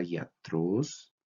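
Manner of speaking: "iatros" with the voice landing on TROS, rising; stress on accented syllable. Shown as a demonstration. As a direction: lean on the last syllable of "iatros". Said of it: "el tono de la voz debe cargarse en la sílaba". ("iatros" con la voz cargada en la última sílaba)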